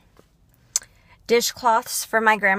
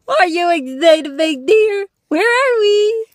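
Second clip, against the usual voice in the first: higher, louder squeaky dog voice; 7 semitones higher, 12 dB louder than usual. Real, not acted.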